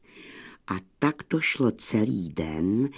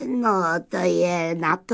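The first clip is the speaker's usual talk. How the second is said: obliging gunner; 6 semitones higher than usual. A narrator's reading. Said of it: "ochotně vysvětloval kanonýr".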